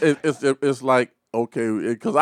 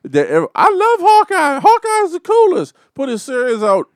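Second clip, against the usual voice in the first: excited; 6 dB louder than usual. Real, not acted. Fan gushing about Hawkeye.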